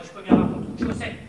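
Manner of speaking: usual speaking voice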